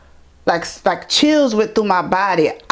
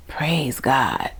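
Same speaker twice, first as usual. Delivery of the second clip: whispered praise; 4 semitones lower, 6 dB quieter than usual. Real, not acted. Grateful aside mid-story.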